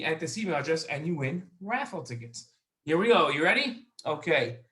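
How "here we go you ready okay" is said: ordinary delivery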